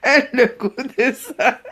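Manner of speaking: helpless real laughter through the words; laughing to tears. Real, not acted. Recovering from her own prank, really laughing to tears. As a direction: talk while cracking up, trailing into laughs. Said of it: "j'ai ri jusqu'à j'ai les larmes".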